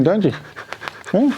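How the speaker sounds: plain reading voice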